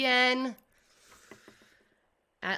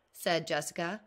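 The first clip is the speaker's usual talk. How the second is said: ordinary delivery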